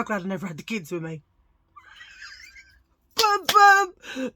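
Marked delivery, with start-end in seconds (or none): 3.1-4.3 high, loud sung ba-dum punchline; rimshot sound. Acted. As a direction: belt out a joke drum hit. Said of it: "That's my dad joke for the day".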